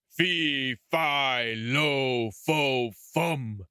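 lightly voiced giant, slow and menacing; a storybook giant sniffing out prey, unhurried; mild mock roar of a giant; giant's roar. A narrator's reading. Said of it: "he roared out".

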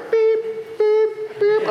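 high mock alarm beeping; alarm beeps. Pastor sensing danger at the gas station, high mock beeping. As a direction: beep like an inner alarm going off. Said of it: "And I was like".

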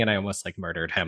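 ordinary talk

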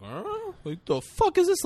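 very high mock-alarmed creature voice; alarmed dinosaur. Dying long neck to the woman touching it, mock alarm. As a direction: squeak out the animal's disbelief.

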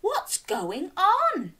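a touch of high, slow puzzled voice; animals asking. A narrator's reading. Farm animals ask what's happening, lightly puzzled. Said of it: "the animals asked".

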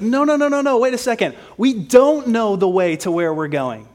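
loud, high flurry of objections; acting out a disciple's confused objection; confused protest. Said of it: "he's confused".

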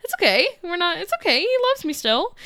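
high, sweet mock-cheerful voice; naive reassurance. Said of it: "So she's like".

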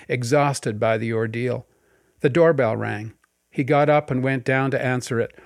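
plain reading voice

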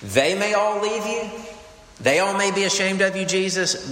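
high, insistent protest as Peter; lightly voiced Peter swearing loyalty; a hint of bold Peter vowing loyalty. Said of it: "Peter speaks up and says".

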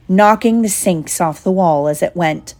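plain reading voice

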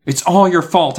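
loud, high accusation; angry shout. A narrator's reading. Gary blaming Miss Carmen, angrily shouting. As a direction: throw the blame out loudly. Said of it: "I shouted angrily".

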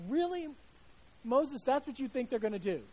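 mock-incredulous outburst. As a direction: scoff in disbelief at him. Incredulous aside to Moses.

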